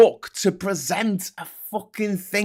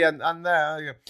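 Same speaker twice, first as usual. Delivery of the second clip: usual speaking voice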